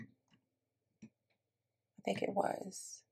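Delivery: slow whisper under her breath; whispered to self. Real, not acted. Checking the cabinet gray, slow private whisper. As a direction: murmur it to yourself, barely voiced.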